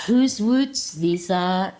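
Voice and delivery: slow, drawn-out 'Whose woods these are'; sing-song poetry voice. Shown as a demonstration. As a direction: overdo the recitation voice. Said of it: "with a wee bit of the poetry voice".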